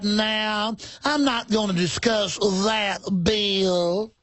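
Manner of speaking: high, slow fluttery Southern belle; Scarlett impression. Host mocking a congressman as Scarlett from Gone with the Wind. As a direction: play a flustered belle dodging the question. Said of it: "Literally talks like Scarlet".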